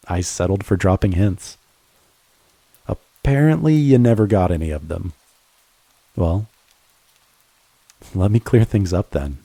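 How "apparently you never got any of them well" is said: ordinary talk, nothing marked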